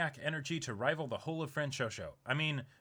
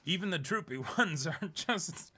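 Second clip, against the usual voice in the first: laughing through it; 6 semitones higher, 4 dB louder than usual. Real, not acted. Host laughs about droopy pompadours, giggling. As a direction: crack up mid-sentence, then recover grandly.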